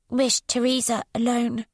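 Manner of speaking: put-on a withdrawn child speaking in fragments; voiced odd young girl. A narrator's reading.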